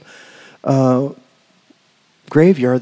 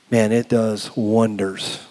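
ordinary delivery

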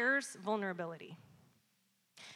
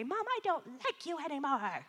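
put-on high, softer little-kid voice; small child Evan; 5 semitones higher, 5 dB quieter than usual. Three-year-old son rejecting his mom, small high child voice. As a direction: imitate a pouting three-year-old, pitched up. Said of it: "Evan's favorite thing right now is to say".

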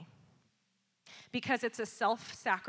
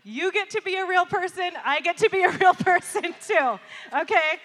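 loud, raised, emphatic self-defence; mock-defensive; 8 semitones higher, 9 dB louder than usual. Real, not acted. Preacher to congregation after confessing she yelled, mock-defensive. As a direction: push back playfully at a higher pitch.

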